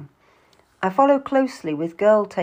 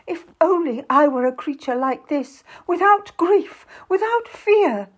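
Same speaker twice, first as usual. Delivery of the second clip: high, louder theatrical lament; crying out; 9 semitones higher, 5 dB louder than usual. A narrator's reading. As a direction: build to a tearful wail. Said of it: "Lucy cries".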